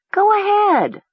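bright, high, coaxing urge; encouraging. Acted. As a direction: cheer her on to start reading. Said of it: "Nessa said encouragingly".

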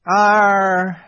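high, drawn-out melancholy sigh of a word. A narrator's reading. Fawn regrets his ignorance, rather melancholy voice. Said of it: "in a rather melancholy voice".

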